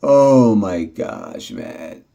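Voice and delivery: long, drawn-out exasperated groan of words. Real, not acted. Vlogger worn down by fruit fly problem, slow exasperated outcry. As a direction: drag each word out slowly, fed up.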